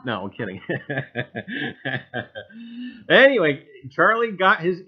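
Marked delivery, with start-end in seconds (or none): 0.6-3.0 real chuckle. Real, not acted. Jokes about his toupee.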